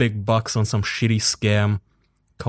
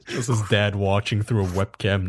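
ordinary delivery

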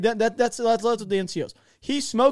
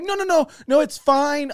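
loud, high-pitched mock pleading; panicked protest; 8 semitones higher, 6 dB louder than usual. Private declining the sister's offer, panicked. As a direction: frantically wave the offer away.

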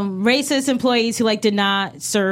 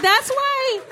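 much higher, bright exclamation; surprised delight; 13 semitones higher than usual. Retold or imitated. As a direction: react with high surprise. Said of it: "And I was like".

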